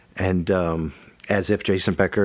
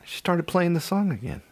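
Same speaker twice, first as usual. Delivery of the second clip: ordinary delivery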